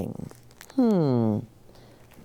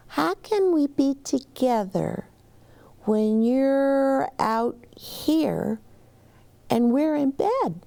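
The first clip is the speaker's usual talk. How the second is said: lightly voiced little girl; 9 semitones higher, slower than usual. Child Liza voiced.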